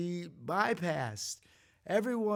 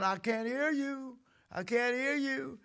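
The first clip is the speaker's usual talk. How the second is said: higher mock drill sergeant bark; 7 semitones higher than usual. Preacher imitating Gomer Pyle's sergeant, lightly mock yelling. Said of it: "a little sergeant going".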